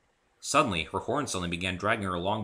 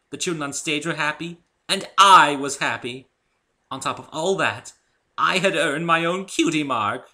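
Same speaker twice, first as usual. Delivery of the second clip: higher and louder, proud Rarity; triumphant; 6 semitones higher, 7 dB louder than usual. Acted. Rarity recounting her cutie mark, proud and thrilled. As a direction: relive the moment with pride.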